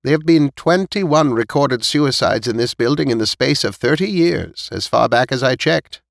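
put-on high-pitched elderly storyteller; elderly neighbour listing past deaths, pitched high; old woman recounting. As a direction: pass on old building gossip in a high old-lady voice. Voices old Missus Knight.

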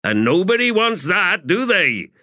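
high, projected crew warning; commanding address. Acted. Corporal warning sappers of Torment, commanding.

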